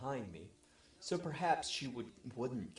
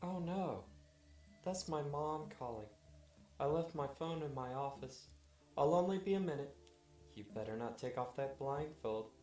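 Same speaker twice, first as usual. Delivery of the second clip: higher-pitched wife, apologetic; lightly voiced wife, higher than the narrator; wife's voice; 4 semitones higher than usual. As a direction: excuse yourself to take mom's call, pitched up.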